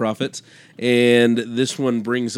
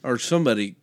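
ordinary talk

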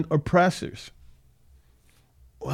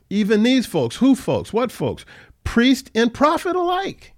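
rising emphasis; 9 semitones higher than usual. Real, not acted. Preacher building on the verse.

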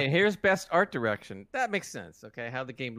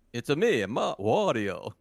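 lower, drawn-out cartoon villain voice; Wario; 4 semitones lower, slower than usual. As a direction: drag out the name in a deep Italian character voice. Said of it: "He spoke with the lower voice".

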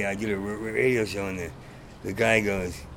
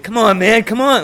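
angry shout; 9 semitones higher, 10 dB louder than usual. Retold or imitated. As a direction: shout it high and loud, fed up. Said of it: "And I was like".

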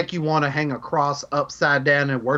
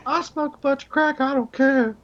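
much higher, silly mock voice; mocking squeaky voice; 12 semitones higher than usual. Host mimicking his co-host's crack joke in a squeaky voice. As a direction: parrot the joke back in a squeaky high pitch.

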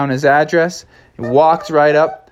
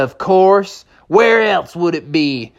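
higher-pitched southwestern cowboy drawl; 6 semitones higher, slower than usual. Acted. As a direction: answer like a southwestern sheriff. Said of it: "talking with a nice southwestern drawl".

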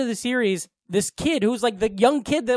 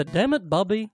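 Cotton impression. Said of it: "I can do the".

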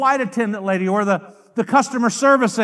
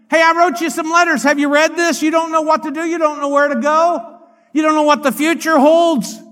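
high, loud preacher voicing scripture; God's word speaking; 7 semitones higher, 8 dB louder than usual. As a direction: call out urgently as scripture addressing the listener. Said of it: "here's God's word going".

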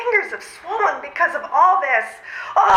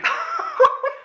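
a high, scornful laugh; mocking laugh; 12 semitones higher than usual. Mocking his confession.

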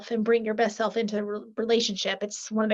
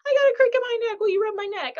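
high, louder pleading neck-rub request; playful whiny ask; 10 semitones higher, 4 dB louder, faster than usual. Retold or imitated. Wife asking husband for a neck rub, high and whiny. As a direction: whine sweetly for a neck rub, pitched up high. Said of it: "And I was like".

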